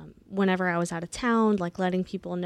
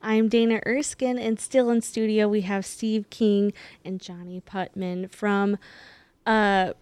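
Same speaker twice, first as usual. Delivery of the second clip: upbeat on-air host intro; morning radio dj; 2 semitones higher, 4 dB louder than usual. Acted. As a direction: warm radio welcome, building to a big call-out. Radio host opening the show.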